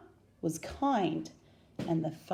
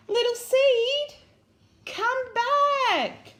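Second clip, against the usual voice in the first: high, loud, drawn-out call; little fox calling out for his lost friend; crying out; 14 semitones higher, 6 dB louder, much slower than usual. A narrator's reading. Little Fox calls after Seed, a high loud cry. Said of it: "cried little Fox".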